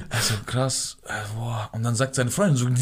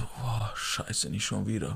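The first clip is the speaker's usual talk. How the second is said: soft, barely voiced mumble; imitating a dazed, hushed waking voice; groggy groan; 3 semitones lower, 12 dB quieter, much slower than usual. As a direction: groggily come round, almost under your breath. Imitates man coming round, groaning.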